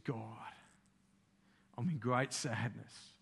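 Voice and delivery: hushed, low, with long pauses; soft, subdued plea to God; acted sorrowful prayer. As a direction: play a bereaved man quietly trusting God. Bereaved man prays to God.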